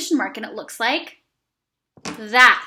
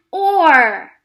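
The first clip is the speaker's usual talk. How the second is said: ordinary delivery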